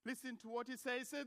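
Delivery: ordinary talk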